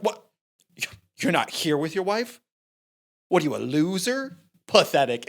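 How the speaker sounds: lightly acted incredulous taunt; mock heckling. Young driver teasing cop about his wife, mock heckling. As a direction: needle him like a cheeky kid, playfully imitated. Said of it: "he starts heckling you".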